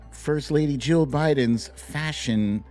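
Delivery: ordinary talk, nothing marked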